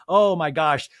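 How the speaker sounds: usual speaking voice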